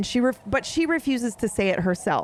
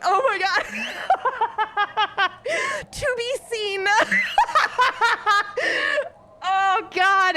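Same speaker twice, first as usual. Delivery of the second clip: much higher voice breaking into laughter; overcome, laughing through the emotion; emotional, near crying; 10 semitones higher than usual. Real, not acted. Reader retelling the line that made them cry, overcome. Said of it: "And I just started crying".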